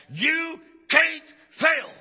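drawn-out shouted declaration; preacher bellowing a promise; slow shout. Acted. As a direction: hammer it home slowly and loud. Repeated line, loud slow.

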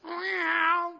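high, loud meow; mock a weak cat's cry. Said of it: "We may take that word and we think".

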